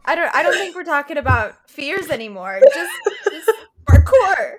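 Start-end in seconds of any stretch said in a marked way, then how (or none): 2.7-4.5 high and loud, laughing while speaking; gasping out the words through laughter. Real, not acted. Still laughing at the dog.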